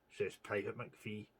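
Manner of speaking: plain reading voice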